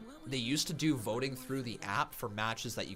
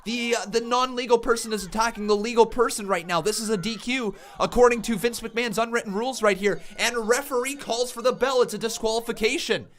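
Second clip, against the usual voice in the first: high, loud wrestling commentator; excited play-by-play; 9 semitones higher, 8 dB louder than usual. Real, not acted. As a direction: shout the call like a ringside announcer. Live wrestling play-by-play call.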